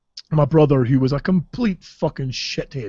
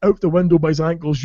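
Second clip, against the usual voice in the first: ordinary delivery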